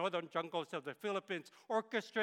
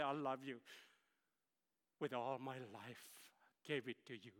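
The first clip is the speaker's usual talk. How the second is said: tender whisper of love; 4 semitones lower, 8 dB quieter than usual. Pretend. God's tender words to believer.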